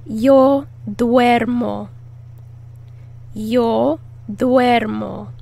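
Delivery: yo duermo said slowly and clearly; slow teaching model. Shown as a demonstration. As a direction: draw out yo duermo for learners. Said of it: "teacher models conjugation".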